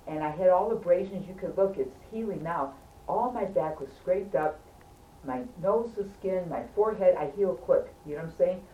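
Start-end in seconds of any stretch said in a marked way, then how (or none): none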